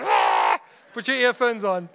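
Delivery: a loud, hammed-up parent holler; mock yell at kids. Father at bedtime yelling to his son, hammed-up shout. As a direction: bark it like a fed-up dad in jest.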